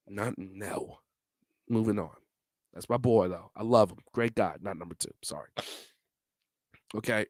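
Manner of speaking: ordinary delivery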